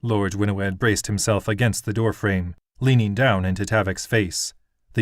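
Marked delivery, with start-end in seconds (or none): none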